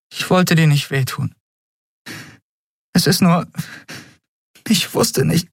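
high, broken-off pleading; halting apology. Acted. Endriel tells Kai she meant no hurt, distressed and halting. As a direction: falter between phrases, pitched high.

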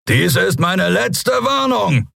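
slow, high-pitched bellow; roared warning. A narrator's reading. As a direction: roar it like a captain facing a mob. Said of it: "brüllte er". (langsam gebrüllte Warnung)

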